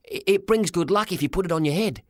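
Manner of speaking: put-on high, hesitant kid explaining; high-pitched boyish explanation; boy's voice. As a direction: pitch it up like a hopeful lad. Boy persuades his father.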